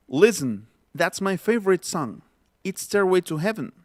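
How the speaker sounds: 'Listen' as LIS-n, 'favorite' as FAV-rit, 'Heaven' as HEV-n; natural English reductions. Shown as a demonstration. Teacher demonstrating the phrase, 'Listen', 'favorite', 'Heaven' all reduced. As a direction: swallow weak vowels in 'favorite' and 'Heaven', no t in 'Listen'. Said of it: "Se pronuncia de esta forma".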